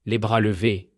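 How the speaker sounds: usual speaking voice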